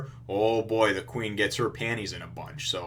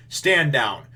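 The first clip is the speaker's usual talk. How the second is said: firm, louder retold command; commanding order; 4 semitones higher, 7 dB louder than usual. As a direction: imitate a captain ordering his men down. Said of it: "he basically tells them".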